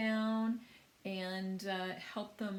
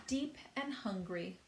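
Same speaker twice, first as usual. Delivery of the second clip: plain reading voice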